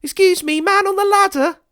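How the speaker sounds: put-on very high, loud child's voice; child calling out. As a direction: shout up like an eager little kid. Child calls up to ladder.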